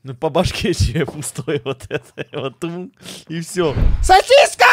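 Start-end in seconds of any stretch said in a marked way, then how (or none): 4.0-4.7 high shout calling a name; calling out loud. Real, not acted. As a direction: yell the name to call them over. Calling the dog loudly. (громкий оклик по имени)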